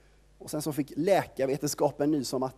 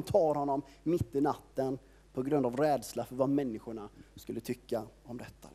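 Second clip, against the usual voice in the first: usual speaking voice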